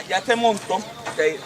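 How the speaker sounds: usual speaking voice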